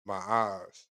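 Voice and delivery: low, soft punchline delivered with a wink; corny punchline. Shown as a demonstration. Host acting out his corny pickup joke, low and soft. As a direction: drop the cheesy line low and smooth. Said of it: "I got a joke for you".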